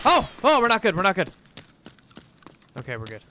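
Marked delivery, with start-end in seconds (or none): 0.0-1.3 alarmed. Real, not acted. Sudden danger in fight.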